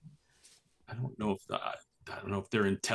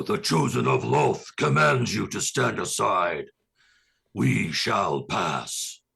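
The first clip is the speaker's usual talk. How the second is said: deep, booming half-elongated proclamation, held short of a full shout; commanding yell in character; 3 semitones lower than usual. Acted. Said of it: "yell out".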